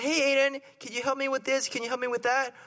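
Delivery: lightly acted mother's request, lifted in pitch; mom asking a favor. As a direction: play a mom asking her kid for help. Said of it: "Imagine if your mom were there".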